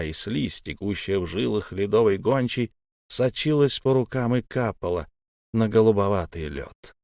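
plain reading voice

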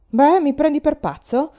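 loud, high-pitched indignant question; shouted. A narrator's reading. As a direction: yell it in outrage. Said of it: "gridò Norman".

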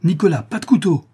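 high, loud urgent command; alarmed cry. A narrator's reading. Widow grabbing at her son's knife arm, urgent cry. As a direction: cry out to stop him. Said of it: "s'écria la veuve en se levant promptement".

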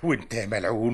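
normal talking voice

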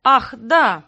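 loud, fake sudden recall; feigned remembering. A narrator's reading. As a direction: pretend it just came to mind. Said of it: "сделал он вид, словно только что вспомнил".

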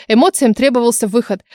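ordinary delivery

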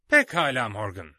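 loud, firm address; stern. A narrator's reading. Long John confronting Morgan before the crowd, stern and loud. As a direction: pin him down with a hard tone. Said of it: "dedi uzun John sert bir tavırla".

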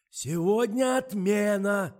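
очень громкий высокий крик; кричит глухой старухе; надрывается. A narrator's reading. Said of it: "надрывался охранник". (надрывный громкий крик)

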